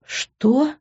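high, rising disbelief; shocked exclamation. A narrator's reading. Daughter stunned by the confession, exclaiming. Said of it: "воскликнула я".

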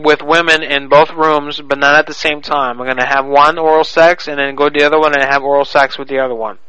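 ordinary talk, nothing marked

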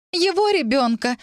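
высокий надрывный возглас; обвини высоко, с отчаянием; истеричное обвинение. A narrator's reading. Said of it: "затряслась в подступающей истерике". (на грани истерики, высоким голосом)

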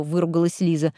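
usual speaking voice

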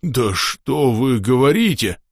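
slow, drawn-out amazement; astounded burbling. A narrator's reading. Axolotl porter reacts to wild rumours, astounded. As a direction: marvel at the news, stretching the words. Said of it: "потрясённо забулькал Ахилот".

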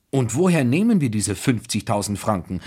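ordinary delivery

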